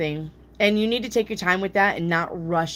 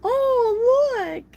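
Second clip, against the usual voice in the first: high, put-on squeaky "oh, look"; mock pretend surprise; 3 dB louder than usual. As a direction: fake a showy little gasp of surprise, much higher. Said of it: "pretend like".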